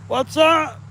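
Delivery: very high, loud, drawn-out shout; excited shout. Real, not acted. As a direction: belt the name out slowly. Naming kitten, shouted.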